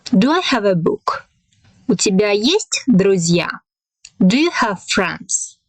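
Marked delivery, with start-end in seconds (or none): 1.9-3.5 sharp rise on "есть", then drop; rising question intonation. Shown as a demonstration. Teacher demonstrating a Russian question to learners, pitch jumping up on "есть". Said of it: "here we have a rising intonation".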